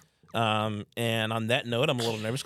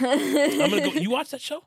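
surprised; 12 semitones higher, 5 dB louder than usual. Real, not acted. Surprised partner loves the show.

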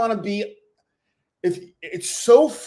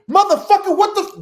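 screamed, much higher and louder; a furious yell; 14 semitones higher, 8 dB louder, faster than usual. Shown as a demonstration. Demonstrating what yelling at her partner would sound like, furious shout. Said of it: "Yelling would be".

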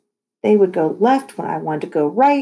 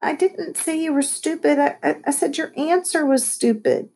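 high-pitched, stumbling self-defence; flustered protest; 9 semitones higher than usual. A narrator's reading. Lexi explaining her insult to Caitlin, flustered. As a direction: backpedal with a stammer.

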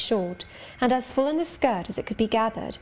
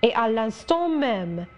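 put-on a young girl pleading excitedly in French; Adele crying out. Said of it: "cried she".

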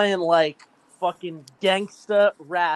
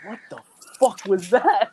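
slow stunned what-the-hell; shocked disbelief; 2 semitones higher, 4 dB louder than usual. Retold or imitated. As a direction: drag it out in disbelief. Retelling his own shocked reaction.

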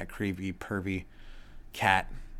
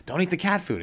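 put-on louder, higher warning to a child; calling to daughter; 9 semitones higher, 4 dB louder than usual. Parent stopping daughter Raina, louder and higher. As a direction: tell the kid no. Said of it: "I'll be like".

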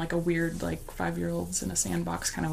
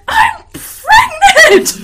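very high, slow, laughing yell; shout; 18 dB louder, much slower than usual. Retold or imitated. Yelling her news at the flirting coworker, loud. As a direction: blast it at the weirdo. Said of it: "I just shout at him".

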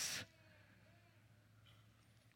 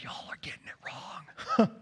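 whispered aside; 4 semitones higher, 7 dB quieter than usual. Acted. Joking aside to congregation.

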